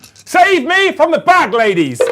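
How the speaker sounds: high, slow, hammed-up cry for rescue; mock desperate plea. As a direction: wail loudly for playful effect. Mock plea over saving throws.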